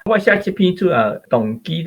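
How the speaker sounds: ordinary talk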